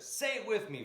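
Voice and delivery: high rousing preacher call; a quick echo of a preacher; preacher's call. Retold or imitated. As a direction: call out to the congregation.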